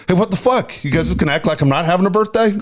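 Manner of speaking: high, hurried mock outrage; mock indignant. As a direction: imitate an affronted gift-grabber. Acting out Jake demanding his birthday gift.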